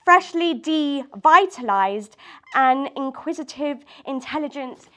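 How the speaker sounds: deliberate, emphatic poetry reading; measured lines with punched key words; spoken-word poem. Acted. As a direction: perform your own confessional poem to a small room. Reads own poem aloud.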